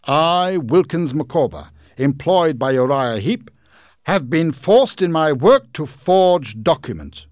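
high, loud formal proclamation; Micawber reading letter. Acted. Micawber reading out Heep's crimes, loud and formal. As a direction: read the charges grandly.

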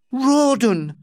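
high startled cry of a name; surprised cry. Acted. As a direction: gasp his name in shock. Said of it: "she cried in surprise".

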